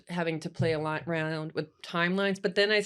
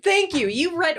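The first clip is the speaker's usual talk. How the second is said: 1 excited; 11 semitones higher, 6 dB louder than usual. Real, not acted. Delighted the cohost's joke matches hers.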